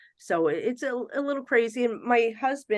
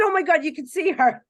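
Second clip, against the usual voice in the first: amazed; 9 semitones higher, 6 dB louder than usual. Retold or imitated. Amazed reaction to friend's sighting.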